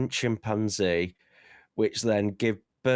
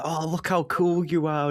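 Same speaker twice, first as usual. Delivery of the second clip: mock gushing praise; 7 semitones higher than usual. Mocking anime characters' praise.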